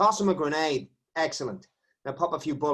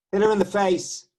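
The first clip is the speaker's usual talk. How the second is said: loud, higher order to hit; shouted order; 6 semitones higher, 8 dB louder than usual. Acted. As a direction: bark the command. Cop ordering a beating.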